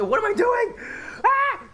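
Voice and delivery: shrieking frustrated scream at the build. Real, not acted. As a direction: yell it out in real despair. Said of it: "Sorry, I screamed".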